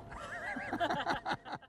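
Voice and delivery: a long, high burst of laughter; real laugh. Real, not acted. Laughs at his own story.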